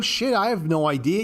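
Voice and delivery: ordinary talk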